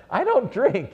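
put-on startled young soldier; shocked protest. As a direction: blurt it out in mock panic. Shocked reply to sergeant.

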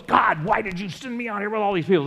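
a loud exasperated plea to heaven; mock complaint to God. Moses complaining to God, loud pleading whine.

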